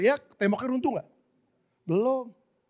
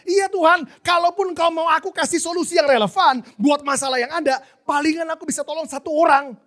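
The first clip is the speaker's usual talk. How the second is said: high, loud, earnest protest; child answering God; 8 semitones higher, 7 dB louder than usual. Acted. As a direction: plead the limits as a kid to God. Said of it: "dia bilang". (anak kecil menjawab Tuhan dengan nada tinggi)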